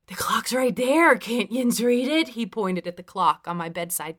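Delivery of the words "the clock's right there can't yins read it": put-on loud, higher drunken Pittsburghese; Pittsburgh accent. A narrator's reading. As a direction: retort in thick Pittsburghese. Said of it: "in full Pittsburghese".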